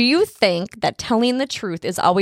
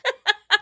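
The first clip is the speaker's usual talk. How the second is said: laughing at own joke; 13 semitones higher than usual. Real, not acted.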